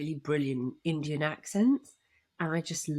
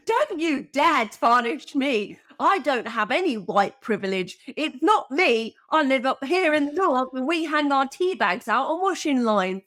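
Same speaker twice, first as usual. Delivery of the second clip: imitating John; 6 semitones higher, 5 dB louder than usual. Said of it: "He's like".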